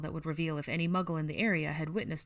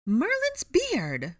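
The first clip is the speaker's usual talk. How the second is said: very high, drawn-out shocked cry; loud, shrill old woman; shrill call; 13 semitones higher, 7 dB louder, much slower than usual. A narrator's reading. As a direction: shriek it from a distance. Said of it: "a shrill voice called".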